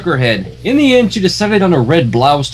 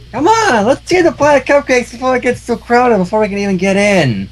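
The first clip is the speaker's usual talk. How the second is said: put-on slurry, high-pitched drunk pony; drunkard pony voice; 9 semitones higher than usual. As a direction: play a tipsy barfly pony. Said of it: "Do I even sound like a drunkard pony?".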